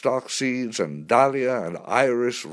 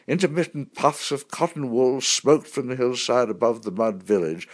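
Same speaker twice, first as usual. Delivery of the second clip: ordinary delivery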